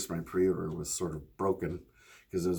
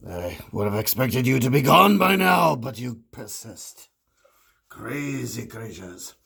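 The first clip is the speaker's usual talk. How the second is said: dark lord; 4 semitones higher, 10 dB louder than usual. Acted. Vlog intro in villain voice.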